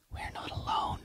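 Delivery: soft breathless whisper. A narrator's reading. Mother warning Rob in a hushed whisper. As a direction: breathe it so nobody else hears. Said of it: "she whispered".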